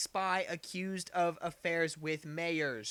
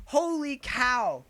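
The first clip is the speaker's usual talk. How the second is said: mock astonishment; 4 semitones higher, 9 dB louder, much slower than usual. Real, not acted. Shocked exclamation.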